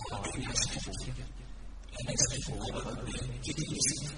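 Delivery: thought voice, set apart; inner voice. A narrator's reading. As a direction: hear his own thought. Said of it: "Địch Nhân nghĩ". (giọng suy nghĩ trong đầu)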